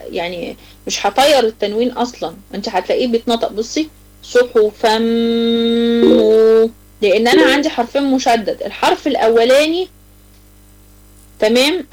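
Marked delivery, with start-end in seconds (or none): 4.2-6.7 صحفا مو said very slowly, the tanwin melting into a long humming mim; تلاوة بطيئة مع غنة; merged tanwin demo. Shown as a demonstration. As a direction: stretch صحفا مو with a held nasal mim. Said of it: "هدخله مع الحرف اللي بعده".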